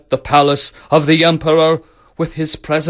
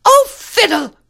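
very high, loud petulant cry; crying-out princess; 5 dB louder than usual. A narrator's reading. Princess rejects the real rose, petulant high cry. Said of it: "cried the princess".

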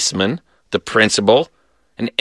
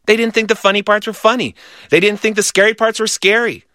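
ordinary delivery, nothing marked

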